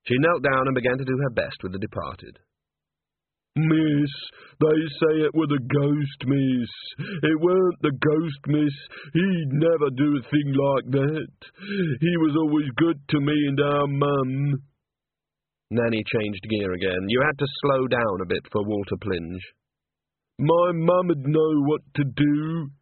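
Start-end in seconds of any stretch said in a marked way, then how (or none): 3.5-14.6 put-on high, slow, fretful young man's voice; a grieving simple lad, slow and high; tearful simple young man. Walter mourning the chorus master, high and slow. 20.4-22.7 put-on high, slow, fretful young man's voice; a grieving simple lad, slow and high; tearful simple young man. Walter mourning the chorus master, high and slow.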